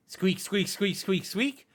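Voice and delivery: high squeaking like rats; rat squeaks. Acted. GM voices approaching rats.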